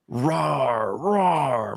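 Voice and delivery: imitated little lion roar, drawn out; mild roar, like a child playing lion; child's lion roar. Daughter roaring to protect her sister, mock lion roar. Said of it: "I'm roaring like a lion".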